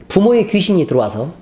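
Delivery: ordinary talk, nothing marked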